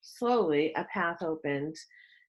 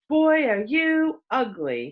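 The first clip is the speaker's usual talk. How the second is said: put-on slow, loud, higher sneering kid; bully taunt; 5 semitones higher, 5 dB louder, slower than usual. A narrator's reading. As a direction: jeer at him like a schoolyard bully.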